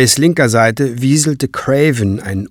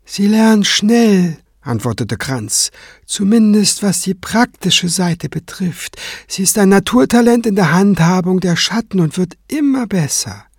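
higher-pitched, measured character voice; composed cleric; 8 semitones higher than usual. Acted. Kranz reporting to Tenebrae on his pupil, composed. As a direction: report evenly, unruffled.

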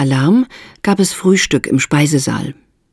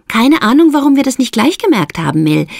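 teasing; 9 semitones higher than usual. A narrator's reading. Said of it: "mischte Maud sich neckend ein".

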